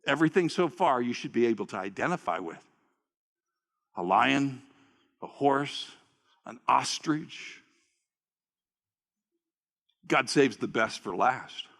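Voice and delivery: low, steady preacher's commentary; preacher explaining to the church, low and steady; low preaching aside. Acted. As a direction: lay it out calmly for the congregation.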